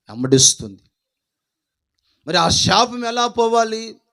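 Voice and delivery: rising, driving sermon cadence; fiery preaching. Acted. Preacher rousing the congregation about the curse, loud and high.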